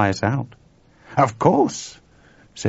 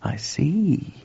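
whispered, thoughtful; slow, quiet; 6 semitones higher than usual. A narrator's reading. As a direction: let it out in a breath. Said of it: "said George slowly".

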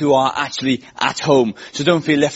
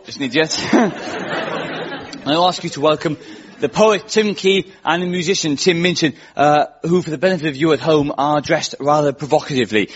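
usual speaking voice

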